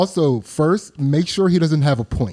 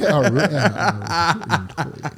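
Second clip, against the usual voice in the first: laughing; 4 semitones higher, much slower than usual. Pretend. Echoing the cowboy bit, laughing.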